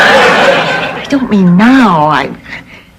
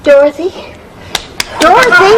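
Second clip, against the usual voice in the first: anxious calling; 8 semitones higher than usual. Acted. Rousing a fainted friend.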